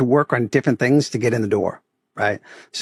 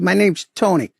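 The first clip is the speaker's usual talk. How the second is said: put-on light Boston accent, a bit higher; 6 semitones higher than usual. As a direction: introduce yourself with Boston flavor. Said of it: "I'll play the Boston accent as much as I can".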